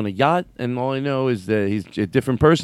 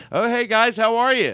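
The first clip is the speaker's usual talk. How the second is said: cheery greeting; 9 semitones higher than usual. Retold or imitated. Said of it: "we go".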